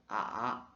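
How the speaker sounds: "A" dipping low then rising; third tone dip. Shown as a demonstration. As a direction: let "A" fall then come back up. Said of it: "descend puis remonte".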